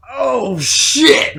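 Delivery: high, loud excited gasp of recognition; excited surprise. Retold or imitated. Friends hearing their song start, excited surprised shout. Both hear the song begin.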